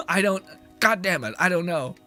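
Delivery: ordinary talk, nothing marked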